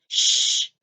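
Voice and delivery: sharp hiss of a shush. A narrator's reading. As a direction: hush them at once. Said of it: "somebody inside the chimney said".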